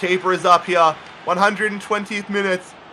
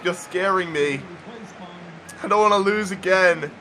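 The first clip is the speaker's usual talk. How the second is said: usual speaking voice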